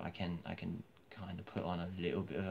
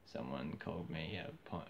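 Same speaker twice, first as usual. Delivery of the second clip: ordinary talk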